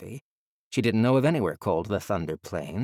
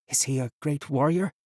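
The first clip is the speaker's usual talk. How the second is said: put-on higher, curious boy voice; boy's voice; 6 semitones higher than usual. A narrator's reading. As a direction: ask with childlike wonder. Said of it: "the boy asked".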